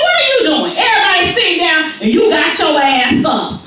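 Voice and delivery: high, loud mock scold; hammed-up angry mother; mama scolding. Mama scolding Tara in class, loud.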